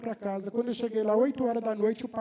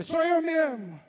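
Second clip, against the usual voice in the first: higher, louder, eager reply; eager answer; 9 semitones higher, 5 dB louder, slower than usual. Retold or imitated. Man who came to see the house answers eagerly. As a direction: jump in gladly, it's me.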